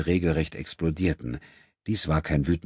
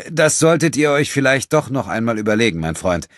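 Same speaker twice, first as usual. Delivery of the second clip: hasty; 7 semitones higher, 7 dB louder than usual. Acted. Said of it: "sagte André rasch".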